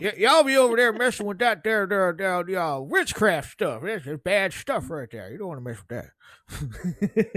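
a hint of a cranky old man; grumpy old boomer. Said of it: "Okay, boomer".

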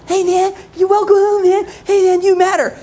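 mock the gushing happy-church crowd; over-the-top greeter. How the coach heard the happy church greet him, a high hammed-up welcome. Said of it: "that was how he experienced the".

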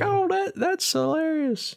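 very high mock gushing; hammed-up high-pitched approval; imitating amused onlookers. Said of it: "everyone's just like".

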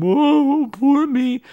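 mock self-pity.

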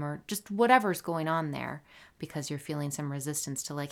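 normal talking voice

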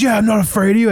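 high-pitched imitation of blustering bravado; mock a guy insisting he's unafraid; mock chirping threat. Imitating the stranger squaring up to him, high mock bluster. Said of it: "He just starts chirping me".

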